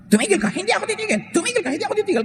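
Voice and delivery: mock quarrel. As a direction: play both sides of a shouting match, mocking. Acted quarrel between spouses.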